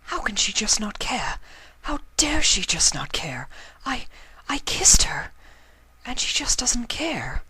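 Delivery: high, breathless whispered disbelief; wounded whispering to herself; hurt whisper. Acted. Hurt mare talks to herself.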